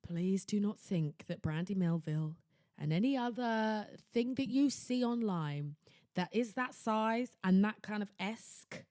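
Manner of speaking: usual speaking voice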